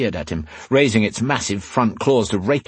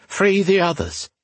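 higher-pitched grumble; grumbling; 10 semitones higher than usual. A narrator's reading. Rion working at the clasps with shaking hands, grumbling. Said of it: "he grumbled".

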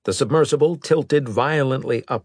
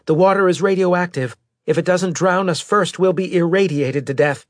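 high, raised voice of alarm; urgent alarm; 10 semitones higher, 5 dB louder than usual. Acted. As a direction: warn the crew urgently, pitched well up. Character warns of deadly flooding.

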